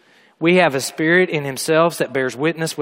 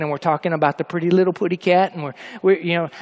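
ordinary talk

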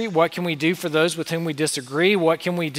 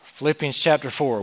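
ordinary talk

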